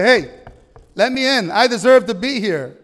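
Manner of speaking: loud, high, mock-confident call; entitled demand. Self-righteous man knocking at heaven's door, loud entitled demand. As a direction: call out as if you own the place.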